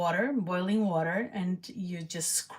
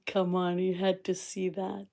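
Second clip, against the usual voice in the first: ordinary talk, nothing marked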